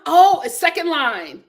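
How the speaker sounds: usual speaking voice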